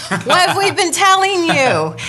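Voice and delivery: high, loud, laughing mock yell; spirit guides yelling. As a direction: play a chorus of guides scolding, half laughing. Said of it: "they're all now yelling at me".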